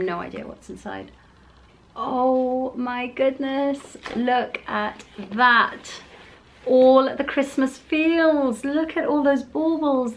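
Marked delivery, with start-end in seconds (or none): 1.9-10.1 higher and very slow, drawn out in awe; awed gushing. Real, not acted. Unboxing Christmas baubles for viewers, slow awed gushing. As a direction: let the wonder stretch out.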